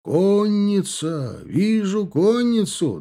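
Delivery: high, long-drawn lookout call; alarmed cry. A narrator's reading. Lookout warning the line of cavalry, alarmed cry. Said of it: "донёсся тревожный крик из цепи".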